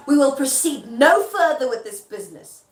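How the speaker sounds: loud, firm theatrical declaration; emphatic stage refusal; standing his ground. Acted. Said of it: "he's trying to stand his ground".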